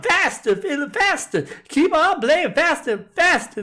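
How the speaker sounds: high, loud hollered commands; hollering. A narrator's reading. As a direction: yell it at the fiddler, driving him on. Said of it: "The widow kept hollering".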